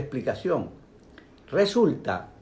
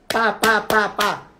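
higher, louder pa pa pa; frantic mock chatter; 7 semitones higher, 4 dB louder, slower than usual. Teacher mimics a mind near an anxiety attack, frantic chatter. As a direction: chatter like a mind about to snap. Mind going crazy with thoughts.

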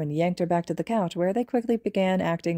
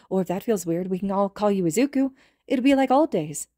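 Toga voice; 4 semitones higher than usual. Acted. Toga higher character voice.

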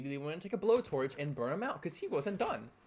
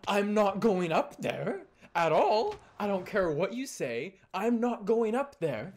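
loud, slow, higher mock protest; adamant refusal; 6 semitones higher, 7 dB louder, slower than usual. Camper refusing to join Zach onstage, adamant protest. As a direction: re-enact digging heels in, loudly.

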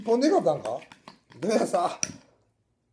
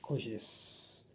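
ささやき声; 5 semitones lower, 13 dB quieter than usual. Real, not acted. First sip of beer.

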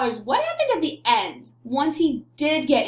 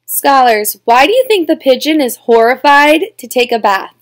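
ordinary talk

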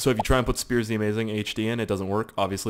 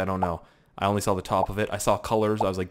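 ordinary delivery

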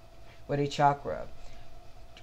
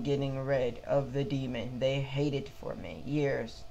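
usual speaking voice

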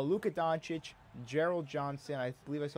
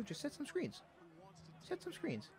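much higher, softer squeaky instruction; mock coaching; 13 semitones higher, 11 dB quieter than usual. Gamer telling his teammates to set screens, high squeaky mock order. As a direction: play a whiny coach to the teammates.

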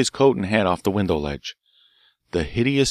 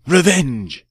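louder, much higher second cry of revenge; 8 semitones higher, 6 dB louder than usual. Acted. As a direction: let the second one explode. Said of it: "Poisson cried".

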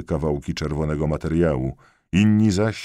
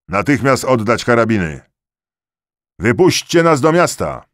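loud, higher-pitched standoff shout; shouted demands; 6 semitones higher, 6 dB louder than usual. A narrator's reading. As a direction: call it out forcefully across a crowd. Standoff between officer and rebellious soldiers. (podniesiony, rozkazujący krzyk)

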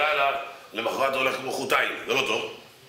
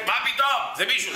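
much higher, jolted awake and protesting; startled outburst; 11 semitones higher than usual. Retold or imitated. Dozing rabbi jolted awake, protesting much higher. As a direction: jump awake indignant.

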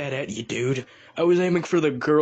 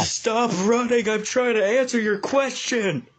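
character voice; 6 semitones higher than usual. Acted. Silver voice.

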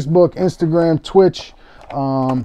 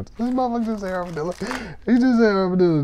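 higher, fast, soft mocking voice; mocking viewer; 7 semitones higher, 5 dB quieter, faster than usual. Breaker imitating a viewer mocking his misread name. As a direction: mimic a viewer laughing at you. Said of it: "you look back and you go".

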